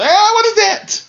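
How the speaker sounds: high squeal of disgust with a laugh. Pretend. Pretending to be Vince, disgusted by gear, laughing squeal. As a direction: recoil at the gear in a laughing screech.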